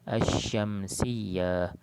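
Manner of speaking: "Asyamsiyah" very slow, sh doubled and held; straight into shin. Shown as a demonstration. Teacher modelling the reading, "Asyamsiyah" slow with long sh. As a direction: drop the L, stretch the sh in "Asyamsiyah". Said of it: "masuk terus dalam shin".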